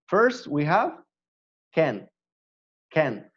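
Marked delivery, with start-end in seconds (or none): 1.7-3.2 "can" said clean with no final T; modelled can. Shown as a demonstration. Teacher drilling the word for the class, clear and even. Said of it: "I'm going to pronounce both of them".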